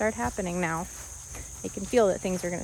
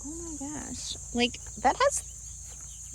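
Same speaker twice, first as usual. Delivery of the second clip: hushed surprise; 6 semitones higher, 6 dB quieter, much slower than usual. Real, not acted. Speaking to chicken eating thorns.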